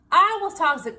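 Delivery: loud, firm I was toxic. Real, not acted. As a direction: say it out with force.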